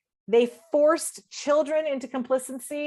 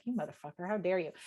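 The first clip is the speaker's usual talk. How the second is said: low, hushed retold swearing; soft, low inner indignation; cursing in one's head; 4 semitones lower, 10 dB quieter, faster than usual. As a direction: curse someone under your breath. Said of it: "cursing in my head".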